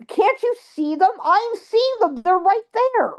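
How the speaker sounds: high, incredulous retold plea; frantic insistence. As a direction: desperately make dad believe you. Retold pleading with disbelieving dad.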